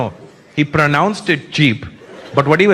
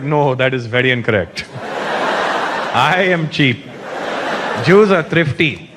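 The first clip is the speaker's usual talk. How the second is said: prim Indian correction; 6 semitones lower than usual. Retold or imitated. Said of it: "We're like".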